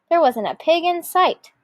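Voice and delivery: usual speaking voice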